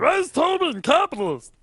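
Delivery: high Muppet-like squawk; silly chat viewer voice. Acted. Caster mimicking viewers looking for the casters, high puppety voice. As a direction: squeak it like a puppet. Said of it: "I'm not even sure what that voice is".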